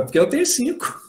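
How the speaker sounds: normal talking voice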